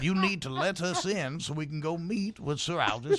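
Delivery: firm but courteous Southern demand; lightly played genteel Southern voice; insistent Southern gentleman. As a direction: insist politely as a Southern gent.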